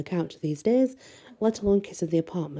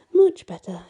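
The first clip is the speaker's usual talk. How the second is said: relieved; 8 semitones higher, 8 dB louder than usual. A narrator's reading. Friend turns creepy doll away.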